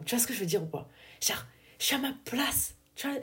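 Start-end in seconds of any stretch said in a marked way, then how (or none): 1.2-3.2 softly whispered confidence. Real, not acted. Confiding to her listener, a soft whisper. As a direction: let it out as a secret. Confiding she feels at home.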